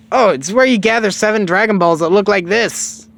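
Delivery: put-on high, loud explaining kid voice; Goku. Goku explaining Dragon Balls, high and loud. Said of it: "He says".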